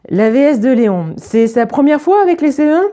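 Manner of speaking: a hint of a curious pupil; lightly voiced child. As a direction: ask it lightly as a schoolchild. Child's question voiced in story.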